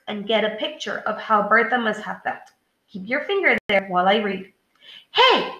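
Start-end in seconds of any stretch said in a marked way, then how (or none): 5.1-5.5 high-pitched "Hey!" shout. A narrator's reading. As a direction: shout it as Ted in read-aloud. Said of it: "Ted shouted".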